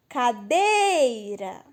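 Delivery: Cadeira with a long, drawn-out "dei"; slow Cadeira to show its strong "dei"; stress on middle syllable. Shown as a demonstration. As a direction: stretch and lean on "dei" in Cadeira. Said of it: "é o mais forte".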